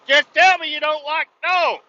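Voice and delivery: high, loud yell at mom; exasperated high-pitched holler; exasperated shout. Retold or imitated. Retort yelled at mom in story.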